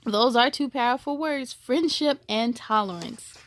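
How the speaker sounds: usual speaking voice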